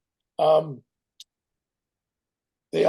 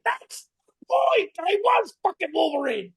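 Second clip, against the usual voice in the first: indignant cry; 13 semitones higher than usual. Retold or imitated. The actor retells hearing he sounds like Wolverine, crying out. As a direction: blurt it out, outraged at the casting. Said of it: "I was like".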